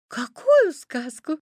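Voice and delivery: high, loud, slow puzzled question; high-pitched girlish surprise; surprised little girl. A narrator's reading. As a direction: ask in wonder as a small girl. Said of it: "удивилась красная шапочка".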